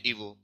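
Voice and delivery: ईवो with v where g is written; read with v. Shown as a demonstration. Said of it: "पढ़ते हैं". (ईवो, ग नहीं व बोलें)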